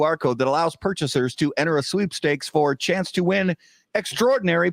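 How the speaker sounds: steady promotional sponsor read. Acted. Host reading an ad to listeners, polished ad read. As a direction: read the sponsor copy smoothly.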